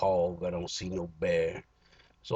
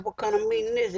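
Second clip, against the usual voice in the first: little uproar; 11 semitones higher than usual. Retold or imitated. Griping at the packed AA meeting, high and indignant. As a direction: squawk it up high like you're fed up. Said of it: "I had a little uproar".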